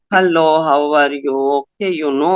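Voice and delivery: low, listless greeting without energy; a bored host mumbling hello to the room; dull uninterested tone. Shown as a demonstration. Greeting the online group in a dull, lifeless tone. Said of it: "Now, this tone of mine".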